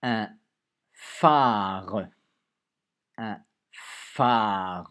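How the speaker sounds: loud stretched "phare"; dictation emphasis. Shown as a demonstration. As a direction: dictate "phare" loudly and slowly. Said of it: "Vous avez la fiche pour le f".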